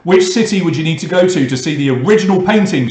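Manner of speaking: usual speaking voice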